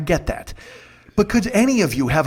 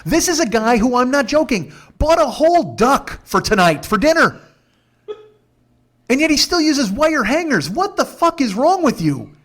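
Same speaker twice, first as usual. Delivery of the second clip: incredulous rant; 10 semitones higher, 4 dB louder than usual. Real, not acted. Comedian venting to listeners about his host's wire hangers, outraged. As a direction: rant at the absurdity.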